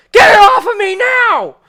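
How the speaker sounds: high, very loud yell; character's shout. Acted. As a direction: shout it in full panicked-command force. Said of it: "Dream shouts".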